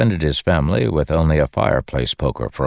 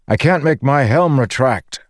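high-pitched raised voice; shouting; 10 semitones higher, 3 dB louder than usual. A narrator's reading. Dalinar telling his companion about his stuck helm, shouting. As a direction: call it out over the wind. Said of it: "Dalinar shouted to him".

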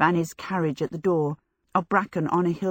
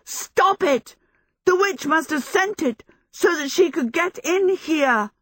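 alarmed urgent plea; 10 semitones higher, 3 dB louder than usual. A narrator's reading. Michael alarmed.

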